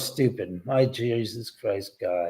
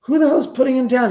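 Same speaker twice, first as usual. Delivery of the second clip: indignant retort; 11 semitones higher, 6 dB louder, faster than usual. Retold or imitated. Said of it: "And I said".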